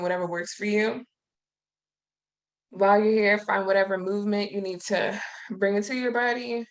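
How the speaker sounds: calm, unhurried breathing guidance with long pauses; guided meditation. Acted. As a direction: gently talk the group into stillness and breath.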